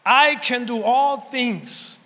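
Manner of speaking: slow emphasis. Real, not acted.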